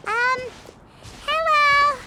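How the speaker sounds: a shy squeak of a greeting; nervous hello. Acted. Paimon nervously greeting a strange lady.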